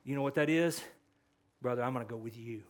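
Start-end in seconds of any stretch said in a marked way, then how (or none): 1.6-2.6 soft, low and quick reassurance; lowered, kindly voice; gentle, compassionate. Acted. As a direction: speak gently, as to a brother in need. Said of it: "speaking the truth gently, with compassion".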